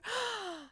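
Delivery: sharp high gasp of horror; horrified gasp. Acted. Hearing of the caretaker, Nancy gasps in horror. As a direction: suck in breath in shock. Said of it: "Nancy gasped in horror".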